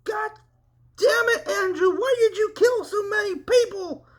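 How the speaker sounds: a high, furious, shouted scream; a full-throated scream of rage and grief. Acted. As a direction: scream it at the dead brother in anger. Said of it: "he cried and yelled".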